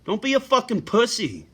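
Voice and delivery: a real, heated call-out to silent viewers; angry rant. Real, not acted. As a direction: chew out the lurkers who never help. Still ranting at viewers.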